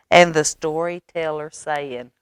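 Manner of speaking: ordinary delivery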